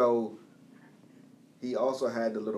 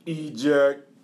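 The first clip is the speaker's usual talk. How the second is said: louder robotic Soundwave call; 3 semitones higher, 8 dB louder than usual. Retold or imitated. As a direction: bark it like a boombox robot. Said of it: "his little weird-ass metallic voice".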